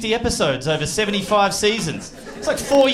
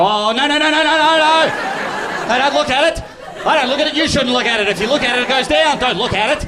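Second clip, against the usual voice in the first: rising higher and louder no's, mock panic; frantic mock panic, building up; panicked refusal; 6 semitones higher, 4 dB louder than usual. Person refusing a credit check, rising mock panic. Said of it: "and you go".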